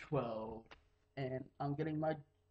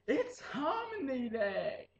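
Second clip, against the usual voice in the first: higher, slow cheery reveal; excited announcement; 8 semitones higher, slower than usual. Retold or imitated. Teacher announcing Harmony Day to the class, cheery. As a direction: reveal the big day with glee. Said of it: "teacher announces".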